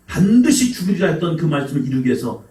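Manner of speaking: ordinary talk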